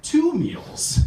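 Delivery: drawn-out puzzled question, lightly French; slow incredulous question. Retold or imitated. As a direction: coworker baffled at the tray. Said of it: "one finally turns to me and goes".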